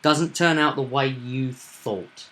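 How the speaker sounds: ordinary talk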